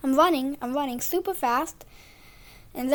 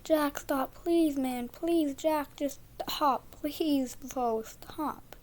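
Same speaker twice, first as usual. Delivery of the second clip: weak hoarse voice; 5 dB quieter, much slower than usual. Retold or imitated. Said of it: "I also lost my voice, so I could barely talk".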